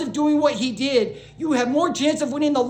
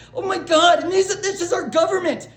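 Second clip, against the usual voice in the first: laugh-crying; 7 semitones higher than usual. Real, not acted. Overwhelmed by what she saw.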